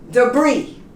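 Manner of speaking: Debris ending in "bree"; silent final S. Shown as a demonstration. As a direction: say Debris with no S at the end. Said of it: "You don't pronounce the S".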